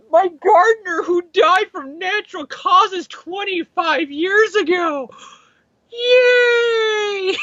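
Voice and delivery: high, slow gushing delight; gleeful mock cheer. Character greeting everyone who has died, hammed-up glee. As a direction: stretch it out in a high, overjoyed squeal.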